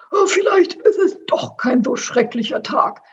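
high, put-on excited character voice; worked-up hamster, pitched high. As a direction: exclaim with sudden new hope. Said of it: "rief er erregt".

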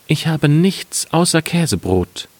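high-pitched wife's voice; Müllersfrau. A narrator's reading. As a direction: answer as the wife, pitched well up. Wife answers husband in story. (Ehefrau mit hoher Stimme)

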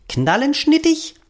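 high, slow, squeaky baffled budgie; a bird trying a strange new word; high chirpy confused query; puzzled bird squeak. Acted. Bird garbles the word again.

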